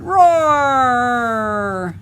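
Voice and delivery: a long, loud drawn-out mock roar; dragon roar. Dragon roaring after falling from the tree, mock roar.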